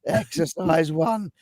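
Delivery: slow, drawn-out copy of a recorded announcement; mimicking a recording. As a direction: echo the clip slowly, lifting at the end. Copies the repeated played clip.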